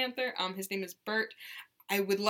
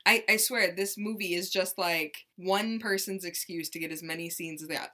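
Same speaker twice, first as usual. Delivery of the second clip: ordinary talk, nothing marked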